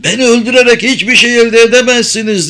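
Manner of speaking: high, loud, fast bitter retort. A narrator's reading. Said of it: "dedi katip acı acı". (acı acı, yüksek sesle)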